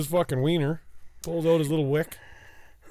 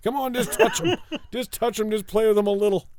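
high-pitched pleading impression of a friend; hammed-up wheedling, much higher; mock begging; 8 semitones higher, 4 dB louder than usual. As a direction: plead and coax in a raised voice. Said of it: "he's begging me".

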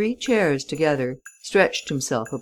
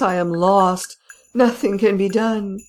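despairing; 5 semitones higher, 4 dB louder than usual. A narrator's reading. Baron despairs after theft.

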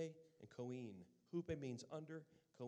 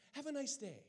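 high, singsong British sign-off; cheery mock-British goodbye; lightly put-on British farewell; imitated British accent; 13 semitones higher than usual. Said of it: "with her British accent".

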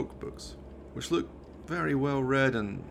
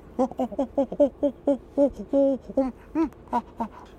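long high chimp hooting laugh; monkey laughing at his mate; chimp cackle; 4 dB louder than usual. Acted.

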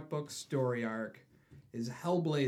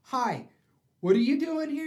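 high, loud awkward hello; comic high-pitched surprise; mock startled greeting; 12 semitones higher, 9 dB louder than usual. John greeting Satan, acted.

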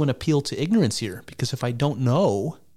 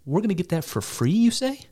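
feigned disbelief; 7 semitones higher than usual. Acted. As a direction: pitch jumping up in mock surprise. Sarcastic doubt at a free vaccine.

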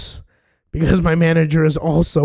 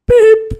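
loud, high electronic beep; one sharp barcode beep; scanner beep; 9 dB louder than usual. Pretend. Cashier imitates scanner.

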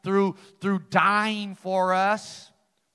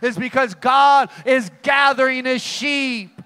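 preaching build to shout; 4 semitones higher, 6 dB louder than usual. Acted.

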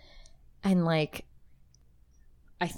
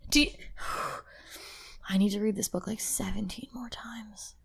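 whispered aside; 4 semitones higher, 4 dB quieter than usual. Real, not acted. Aside about rereading the book.

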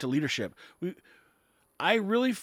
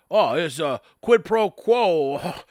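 slow, louder sarcastic mock voice; mocking sneer; 7 dB louder, much slower than usual. Mocking Trump's accusers, in a slow, loud sarcastic drawl. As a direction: drag it out to ridicule them.

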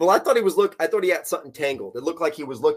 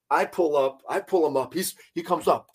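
ordinary talk, nothing marked

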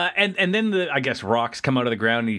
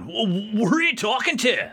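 high, stammering put-on voice; mock baffled idiot; 8 semitones higher than usual. Ironhide looking around for who Kicker hears, high mock bafflement. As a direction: stammer it in hammed-up high confusion.

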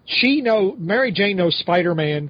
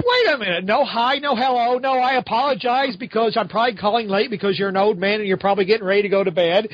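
higher, indignant mock complaint; exasperated retort; 4 semitones higher than usual. Grumbling to the co-host about the late call, exasperated. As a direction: act put out at the rude call. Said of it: "And it's like".

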